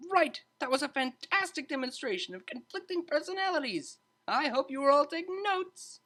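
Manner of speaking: high, bright-toned teacher breaking up a fight; a lively tutor stepping in to calm two students; cheery tutor voice. A narrator's reading. Tutor calming arguing students, high cheerful voice.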